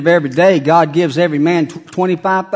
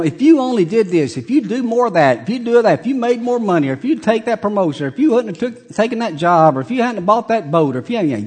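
higher, nagging list of complaints; nagging wife; 4 semitones higher than usual. Acted. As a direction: whine out every grievance. Voicing nagging blaming wife.

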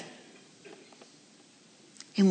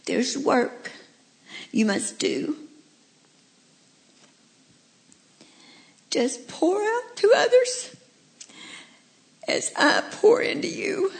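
tender words through real tears; high, breaking voice, gently entrusting a task; kindly, tearful; 9 semitones higher, slower than usual. Acted. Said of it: "Spoke to it kindly".

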